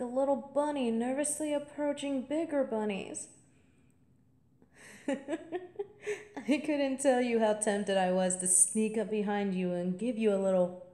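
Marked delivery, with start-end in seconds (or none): 5.2-6.5 a high playful giggle; giggly, mischievous laugh; high teasing laugh. Acted. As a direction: laugh at the thought of startling her. Teasing laugh about scaring the freshman.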